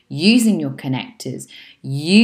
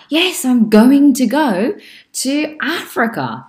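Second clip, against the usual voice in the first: higher and louder, eager "Yes, I'm going to go"; enthusiastic answer; 9 semitones higher, 8 dB louder than usual. Shown as a demonstration. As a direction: answer like you can't wait. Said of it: "then you reply".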